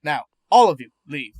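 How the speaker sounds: loud, sharp order to clear the room; commanding shout to the staff. Acted. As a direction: raise the voice and send them all out. Doctor dismisses the staff.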